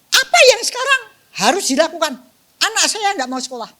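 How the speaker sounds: loud, high acted outburst; angry mother. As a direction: demand an answer as a fuming mother. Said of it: "Kalau kemarahan tadi ceritanya seperti itu". (ibu marah menuntut dengan suara keras)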